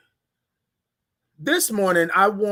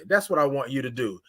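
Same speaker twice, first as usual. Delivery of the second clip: normal talking voice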